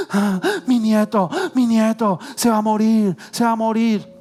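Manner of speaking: high, slow frantic wail of a grandmother; panicked grandmother. Retold or imitated. Neighbour grandmother wailing to Jorge about her grandson, frantic and high.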